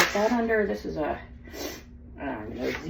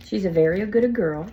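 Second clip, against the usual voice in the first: ordinary talk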